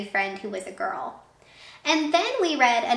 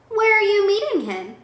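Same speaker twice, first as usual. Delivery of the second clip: nervous owl; 10 semitones higher, 5 dB louder than usual. Acted.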